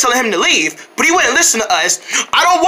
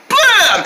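defiant nonsense blurt; shouted blam; 9 semitones higher than usual. Acted. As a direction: bark out the nonsense word. Elephant keeps saying blam.

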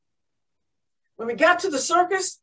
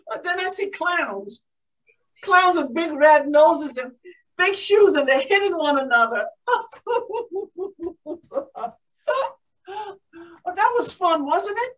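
ordinary delivery

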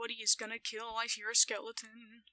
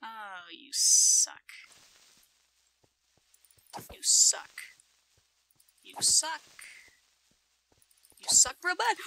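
loud taunt with drawn-out words, climbing higher; heated taunt; 3 semitones higher, 10 dB louder, slower than usual. Real, not acted. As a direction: jeer at the enemy, louder and higher each time. Taunting a game enemy while fighting.

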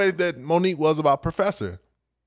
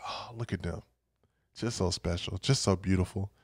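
very soft, low murmur; hushed adoration; 8 semitones lower, 9 dB quieter than usual. Retold or imitated. God watching us sleep, hushed and adoring. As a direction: marvel quietly, as if not to wake the sleeper.

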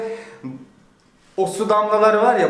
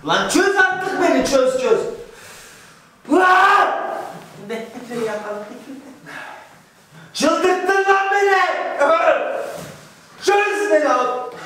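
frustrated yelling; 7 semitones higher than usual. Real, not acted. Tied up, begging to be untied.

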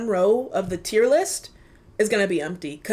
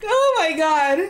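tearful; 8 semitones higher, 4 dB louder, slower than usual. Real, not acted. Overwhelmed by the album.

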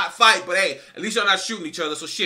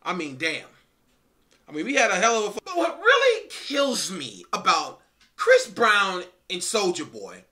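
ordinary talk, nothing marked

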